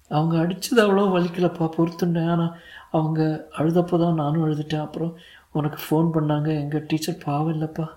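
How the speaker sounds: put-on high-pitched little boy voice; sad small boy. A narrator's reading. Son explaining his teacher's trouble, sad small boy voice. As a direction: pitch it up as a child confiding.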